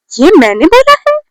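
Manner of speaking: high, rising disbelief; incredulous question. Retold or imitated. Accused student questions the claim to her friend, incredulous. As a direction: throw it back as a disbelieving question.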